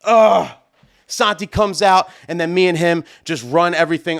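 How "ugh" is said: loud, higher groan of dismay; exasperated "ugh"; pained groan. Retold or imitated. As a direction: groan as if watching prized gear get ruined. Retold dismay at soaked microphone.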